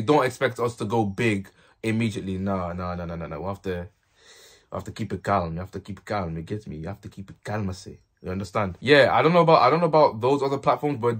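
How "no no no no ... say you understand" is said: softly, lower, calming the viewer. Real, not acted. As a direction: hush them down gently. Telling viewers to stay calm.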